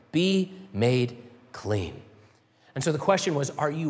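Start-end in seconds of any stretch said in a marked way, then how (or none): 0.1-2.0 tender command. Acted. Jesus quoted healing the leper.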